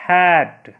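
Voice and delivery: हैट said loud and clear, the vowel as in hat; sounds out hat. Shown as a demonstration. Teacher showing pupils how HAT is read, clear demo. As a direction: pronounce हैट crisply with the short ae sound. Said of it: "तो इसको हम लोग क्या पढ़ते हैं".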